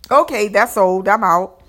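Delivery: mock listeners tossing the song aside; dismissive listeners. Listeners done with an old hit, loud brush-off. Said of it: "And people are like".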